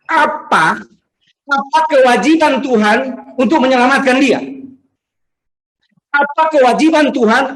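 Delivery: high-pitched, emphatic preacher's challenge; impassioned sermon delivery; forceful preaching. Real, not acted. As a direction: hammer out rhetorical questions to the congregation.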